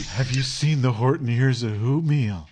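lightly voiced stoner surfer dude; Spicoli-ish friend. Imitating the friend like Spicoli, lightly done. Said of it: "kinda talks like Spicoli".

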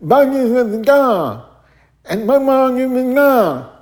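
high, loud imitation of a deaf man's speech with blurred words; imitating deaf speech. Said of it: "sounded like he was talking with an accent".

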